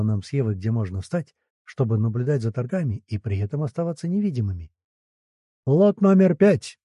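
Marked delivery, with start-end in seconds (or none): 5.7-6.8 loud, slow auction call; booming prize announcer. A narrator's reading. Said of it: "ведущий объявил очередную партию торгов".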